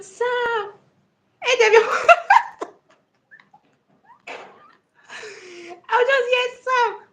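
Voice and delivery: high, loud squeaky calling; cockroach voice. Retold or imitated. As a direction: shriek the name like a cockroach voice. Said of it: "with your cockroach voice".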